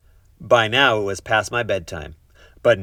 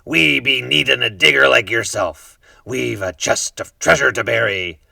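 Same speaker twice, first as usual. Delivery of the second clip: pirate captain voice; 4 semitones higher, 7 dB louder than usual. A narrator's reading. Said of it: "he said".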